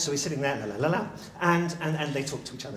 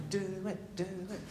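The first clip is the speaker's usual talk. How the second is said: soft, higher-pitched chant from the corner; goading onlookers; 5 semitones higher, 11 dB quieter than usual. Acted. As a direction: quietly egg him on, pitched up. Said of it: "like this kind of thing".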